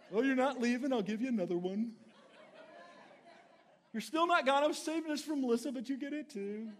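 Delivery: preacher mockingly giving away joy to a yeller, laughing; mock generous. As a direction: hand it over with hammed-up cheer.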